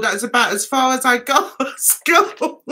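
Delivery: dissolves into helpless laughter; real laugh taking over the words. Real, not acted. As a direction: start the sentence straight, then crack up. Cracks up at own German.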